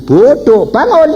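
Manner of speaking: loud, high acted command; scolding shout. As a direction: shout as if rousing someone foolish. Acted scolding command in retold dream.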